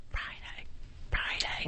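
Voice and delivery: barely audible, voiceless whisper; mouthed whisper. Retold or imitated. The woman signalling a correction to the on-air reader, whispered. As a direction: mouth it almost silently. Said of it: "she's doing this at me".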